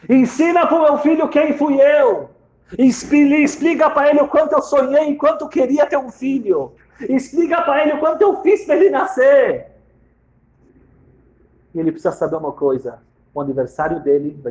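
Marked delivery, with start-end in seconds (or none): 0.1-9.7 anguished plea. Acted. Dying wife begging husband to tell their son about her. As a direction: cry it out as a final wish. Wife's last request, repeated.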